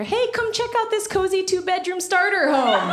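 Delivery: high, chirpy sales pitch. Retold or imitated. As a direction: sell the house like an eager realtor.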